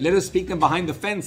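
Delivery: loud pleading request; urgent plea. Retold or imitated. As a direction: beg them to let him live. Pleading on behalf of the dying man.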